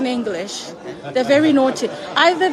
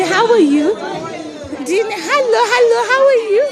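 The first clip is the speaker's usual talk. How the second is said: excited greeting; 8 semitones higher, slower than usual. Real, not acted. Greeting auntie warmly.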